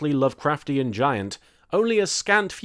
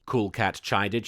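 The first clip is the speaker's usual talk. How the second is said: ordinary delivery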